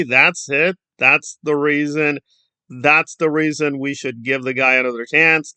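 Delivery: ordinary delivery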